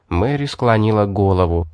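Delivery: plain reading voice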